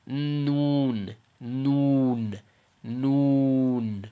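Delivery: "Noon" with a long held oo and n; Arabic noon. Shown as a demonstration. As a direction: draw "noon" out slowly, tongue forward. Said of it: "When you say it in Arabic".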